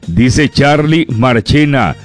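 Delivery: normal talking voice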